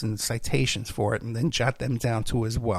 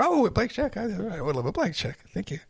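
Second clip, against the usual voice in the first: mock delighted; 7 semitones higher, 4 dB quieter than usual. His own greedy mock reply.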